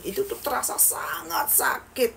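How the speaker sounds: eerie pained whisper; hushed spooky whisper. Acted. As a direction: breathe it out as a ghostly whisper. Describing pain eerily.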